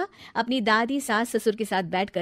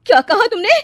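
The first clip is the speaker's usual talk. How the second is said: stung fury; 11 semitones higher, 12 dB louder than usual. Acted. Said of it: "तिलमिला उठती है".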